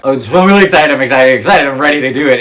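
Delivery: high, loud, gushing retold excitement; excited anticipation. Shopper replaying her hopes before bad news, excited. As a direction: bubble over as you replay your own excitement.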